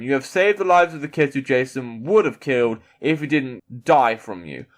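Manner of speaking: ordinary delivery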